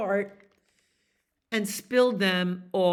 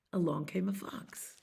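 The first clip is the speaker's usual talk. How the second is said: ordinary delivery, nothing marked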